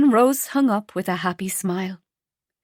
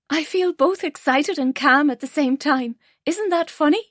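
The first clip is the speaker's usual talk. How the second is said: put-on a touch higher, giddy young woman; lightly voiced young woman; 7 semitones higher than usual. A narrator's reading. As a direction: play Rose, light and happy. Rose voiced as character.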